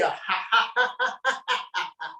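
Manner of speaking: ha ha ha laughter; laughing. Shown as a demonstration. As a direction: laugh it out freely. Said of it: "Look at it and laugh and say".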